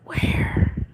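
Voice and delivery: hushed, mock-secret whisper; playful whisper. Streamer whispering to a long-missed viewer. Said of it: "whispered greeting".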